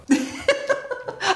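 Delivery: high airy burst of laughter. Real, not acted. Laughing at his own story of the commissioner.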